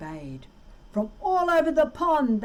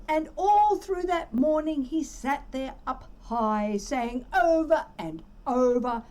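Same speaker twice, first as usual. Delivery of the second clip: ordinary delivery, nothing marked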